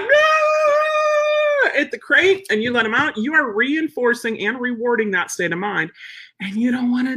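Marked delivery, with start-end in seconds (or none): 0.0-1.7 high yelping dog voice; dog's frantic no. Retold or imitated. Dog in the crate protesting its owner, high yelp. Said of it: "your dog's like".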